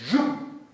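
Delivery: a sharp high zum shot out loud; zoom sound. Retold or imitated. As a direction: fire it off like a released spring. Spring-like lunge toward mother. (¡zum! agudo y fuerte, como un resorte)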